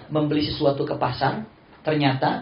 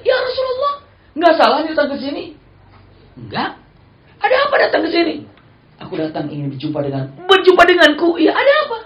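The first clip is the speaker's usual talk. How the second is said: high, loud surprise; astonished master; 9 dB louder than usual. Retold or imitated. Master astonished the Prophet is at his door, high and loud. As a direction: gasp it out in disbelief.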